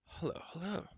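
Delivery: soft, faint, half-conscious greeting; quiet dazed murmur. A narrator's reading. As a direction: murmur it as if just waking. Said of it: "he murmured".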